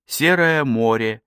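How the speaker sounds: ordinary delivery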